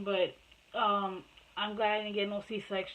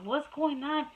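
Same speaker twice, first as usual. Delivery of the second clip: alarmed; 6 semitones higher, 3 dB louder, slower than usual. Retold or imitated. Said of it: "I was like".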